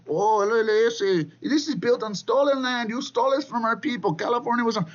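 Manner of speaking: high, strained mock-protester voice. Commentator mimics protesters claiming stolen land, mocking high voice. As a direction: whine it up high, ridiculing their complaint. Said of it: "seeing is these people".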